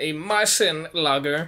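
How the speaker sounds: slow mock German accent; lightly faked German accent; faux German accent. Shown as a demonstration. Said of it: "excuse my faux German accent".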